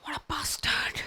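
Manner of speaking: hissed whisper under breath; whispered curse. Retold or imitated. Wife realising the trick, whispered curse. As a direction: swear it under your breath.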